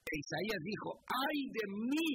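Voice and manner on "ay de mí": a touch of a lamenting cry. Acted. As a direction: voice Isaiah's lament, lightly. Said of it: "se lamentó, se dolió". (un leve lamento de Isaías)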